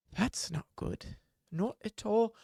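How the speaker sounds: hushed, slow that's not good; grave healer whispering over the wound; whispered worry. Acted. Cleric sees damage remains.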